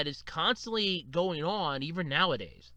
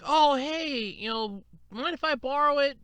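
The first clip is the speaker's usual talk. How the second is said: lightly imitated higher-pitched asker; casual borrower; 7 semitones higher than usual. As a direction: lightly mimic someone casually asking a favour. Voices person asking to borrow.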